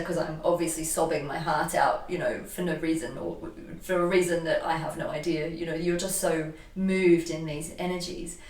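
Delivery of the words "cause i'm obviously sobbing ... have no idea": ordinary talk, nothing marked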